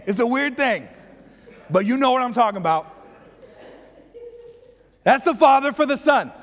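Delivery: ordinary delivery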